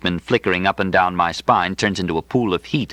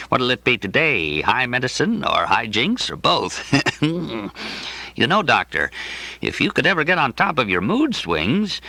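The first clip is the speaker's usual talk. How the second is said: higher, genial voice of the coughing clinic director; amiable director; 4 semitones higher than usual. Acted. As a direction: play the bulging-eyed director, kindly and a bit vague.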